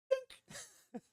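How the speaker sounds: tiny high-pitched ding of the bike tipping; high tinny dink. Retold or imitated. Rider mimicking his bike tapping the grass, high tiny dink. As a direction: squeak it out like a light metal tap. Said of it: "and it goes".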